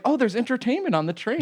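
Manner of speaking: high-pitched, delighted young woman impression; imitating naive newcomer. New New Yorker seeing a train singer, mock delight. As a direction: gush like a wide-eyed new arrival.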